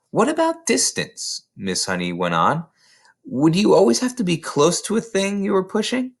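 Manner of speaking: high, bright probing questions; curious eagerness. A narrator's reading. Miss Honey quizzes Matilda about distance, high and curious. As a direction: lean in, hungry to know.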